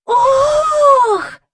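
very high, loud, drawn-out oh; joyful cry. A narrator's reading. Jane recognising the figure above, joyful cry. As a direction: gasp out loud in delight. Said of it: "радісно вигукнула Джейн".

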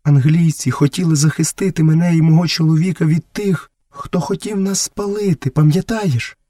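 fearful woman's voice, pitched high; lightly voiced anxious mother; timid. A narrator's reading. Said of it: "боязко промовила Месуа".

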